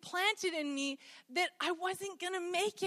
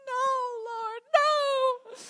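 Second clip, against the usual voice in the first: higher, crying out no; crying no to the Lord; mock crying plea; 9 semitones higher, 4 dB louder, much slower than usual. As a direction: whine it like a hammed-up sob. Refusing God's call.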